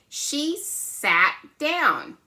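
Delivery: very slow, lifted example sentence; drawn out slowly for little learners. Shown as a demonstration. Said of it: "example sentence".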